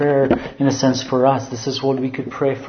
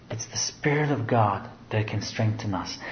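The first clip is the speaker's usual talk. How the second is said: hushed, breathy whisper to the congregation; 3 semitones lower, 7 dB quieter than usual. Real, not acted. Preacher to congregation, a whispered reverent aside. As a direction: lean in and whisper it like a secret.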